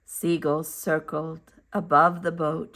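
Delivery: plain reading voice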